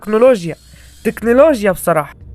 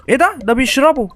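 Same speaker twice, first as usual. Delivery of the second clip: surprised; 6 semitones higher than usual. Real, not acted. Sudden sight in the game.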